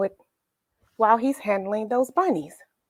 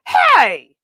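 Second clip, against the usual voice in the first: Mike calling out; 6 semitones higher, 7 dB louder than usual. A narrator's reading. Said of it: "said Mike".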